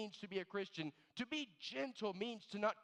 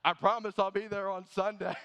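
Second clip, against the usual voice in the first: sheepish excuse through laughter, getting louder; laughing mock excuse; 8 dB louder than usual. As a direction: fumble an excuse while laughing. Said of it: "And then they say".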